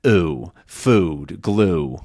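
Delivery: "ooh, food, glue" with the everyday English oo. Shown as a demonstration. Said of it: "you're gonna mostly hear".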